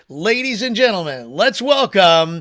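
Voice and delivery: drawn-out ring-announcer intro, name shouted high and loud; announcer introduction. Real, not acted. Host welcomes the speaker to the audience, hyped announcer call. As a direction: build up to a big, stretched shout of the name.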